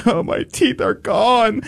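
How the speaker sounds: high, drawn-out fake wail; hammed-up sobbing lament; mock crying. Patient mourning pulled teeth, mock crying. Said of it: "I'm not crying".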